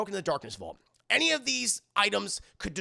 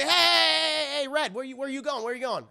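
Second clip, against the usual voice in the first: high, loud hey, then easing in volume; calling out after someone; 7 semitones higher, 5 dB louder than usual. Retold or imitated. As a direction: holler to stop them leaving, voice raised in pitch. Yusuke calls after someone leaving.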